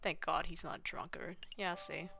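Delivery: hushed whispered aside; soft conspiratorial whisper to the viewers. Real, not acted. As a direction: whisper it as if the game could hear. Aside about the drunk character.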